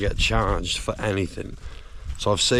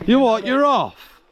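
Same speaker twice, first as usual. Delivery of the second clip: calling out; 12 semitones higher than usual. Real, not acted. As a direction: shout it over to the far bank. Shouting across lake.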